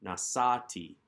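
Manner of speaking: "nasati", weight on the middle "sa"; penultimate stress. Shown as a demonstration. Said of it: "accent remains in the prior syllable".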